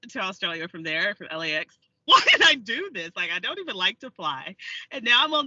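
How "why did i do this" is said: loud, dismayed self-questioning; exasperated outburst. Retold or imitated. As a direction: burst out in mock regret. Recalls her regret on the flight.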